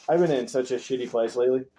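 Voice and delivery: normal talking voice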